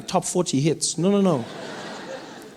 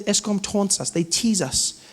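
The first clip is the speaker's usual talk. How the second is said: ordinary talk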